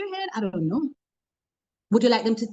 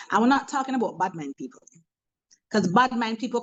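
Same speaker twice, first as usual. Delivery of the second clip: ordinary talk, nothing marked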